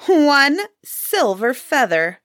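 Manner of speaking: high, slow disbelief; indignant cry. A narrator's reading. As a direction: cry it out as the scornful wife, stretching the words. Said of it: "Helly's wife cried".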